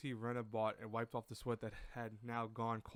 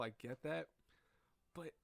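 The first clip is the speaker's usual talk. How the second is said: ordinary talk, nothing marked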